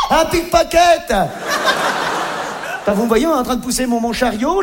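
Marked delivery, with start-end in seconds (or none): 0.1-1.1 slow, high, loud cry; mock shout. Naked shopper crying thief, mock shout. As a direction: yell it at the thief.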